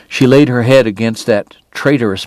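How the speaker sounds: ordinary delivery, nothing marked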